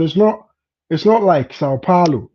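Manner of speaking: normal talking voice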